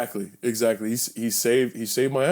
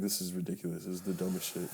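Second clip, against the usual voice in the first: quiet; 5 semitones lower, 13 dB quieter, faster than usual. Retold or imitated. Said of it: "I'm like".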